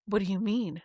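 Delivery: low-key imitation of a clueless man; clueless quote. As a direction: ask it mildly, as if innocently puzzled. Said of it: "and then he's like".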